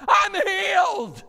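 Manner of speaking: a sudden, very high, very loud cry of joy; ecstatic shout. Retold or imitated. Elder leaping up mid-sermon, shouting with joy. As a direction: belt it out like a man who was just healed. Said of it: "jumped up during the message and went".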